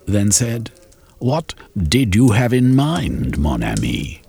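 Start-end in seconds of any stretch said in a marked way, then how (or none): 1.2-4.2 Sir Hosley's voice. Acted. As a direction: tease him languidly. Sir Hosley, French flourish.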